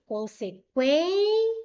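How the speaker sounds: "Consequen-" with quen high, loud and long; stress on QUEN. Shown as a demonstration. Teacher showing the stronger syllable, "Consequen-" with long, loud, high QUEN. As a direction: stretch and punch the last syllable of "Consequen-". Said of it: "penúltima sílaba mais forte".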